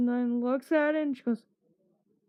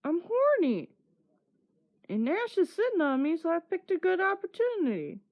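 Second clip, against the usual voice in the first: higher, fast, cheeky mock voice of him; mock horny guy; 6 semitones higher than usual. Him explaining himself to the group in a cheeky higher voice. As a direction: rattle it off high like the sly guy who got caught. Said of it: "He was like".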